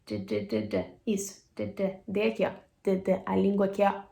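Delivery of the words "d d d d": repeated voiced D, low and soft; isolated D sound. Shown as a demonstration. Teacher drilling the D sound for students. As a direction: tap out a bare D. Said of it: "Só D".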